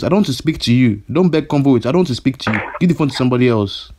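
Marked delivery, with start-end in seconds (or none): none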